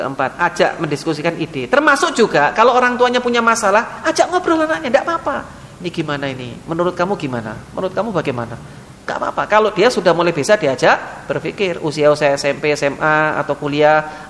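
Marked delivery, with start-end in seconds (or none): 5.8-8.6 gentle asking. Acted. Parent asks child's opinion.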